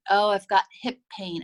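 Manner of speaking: usual speaking voice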